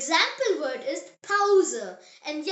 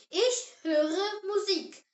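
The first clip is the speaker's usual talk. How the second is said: usual speaking voice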